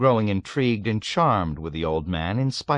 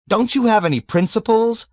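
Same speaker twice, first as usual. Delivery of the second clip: much higher, louder indignant demand; outraged question; 8 dB louder than usual. A narrator's reading. Nately demands principles from the old man, outraged. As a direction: challenge him, scandalised.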